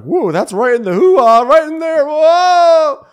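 very high, loud yelping; hammed-up yell, climbing to a howl; mock shocked shriek. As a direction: shriek as if hit by freezing cold. Acting her shock in cryo chamber.

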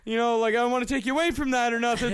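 whiny nice guy talking fast; pushy guy voice. Retold or imitated. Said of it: "He's like".

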